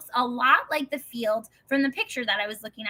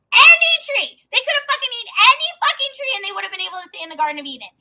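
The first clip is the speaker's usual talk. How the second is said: high-pitched yelling, racing along; frantic, incredulous rant; exasperated outburst; 11 semitones higher, 8 dB louder, faster than usual. Retold or imitated. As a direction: shout in outraged disbelief, easing at the end. Exasperated outburst at God retold.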